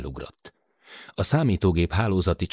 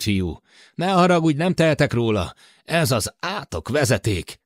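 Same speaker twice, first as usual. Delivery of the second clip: put-on higher, worried older man over the intercom; Matt's voice; 6 semitones higher, 3 dB louder than usual. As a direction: voice Matt, anxious about the short circuit. Matt's voice in the read story. (Matt aggódó hangja)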